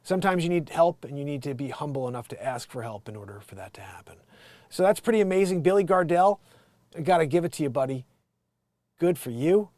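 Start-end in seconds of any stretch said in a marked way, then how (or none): 1.0-4.2 whisper. Real, not acted. Whispered aside on asking help.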